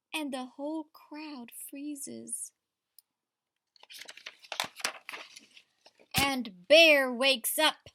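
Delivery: lively read-aloud to a class; story reading to children. A narrator's reading. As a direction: read it like a picture book at story time. Teacher reads picture book aloud.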